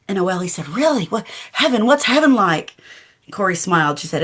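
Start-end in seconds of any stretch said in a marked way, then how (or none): 0.7-2.7 put-on higher, wide-eyed child's eagerness; a little girl who can't wait to know; eager child. Child Oeli asks about heaven.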